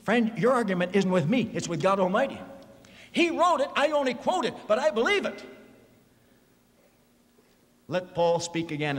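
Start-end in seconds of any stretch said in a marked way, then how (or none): 3.1-5.4 high, insistent preacher's declaration; emphatic preaching. Real, not acted. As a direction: drive each phrase home, pitched high.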